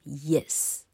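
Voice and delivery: yes with the opening y drawn out; lengthened y glide into yes; model pronunciation. Shown as a demonstration. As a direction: say yes slowly for students to copy. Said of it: "同学跟[name]一起念念看".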